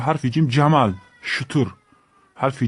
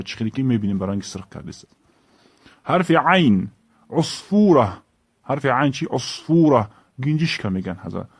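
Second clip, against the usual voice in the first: normal talking voice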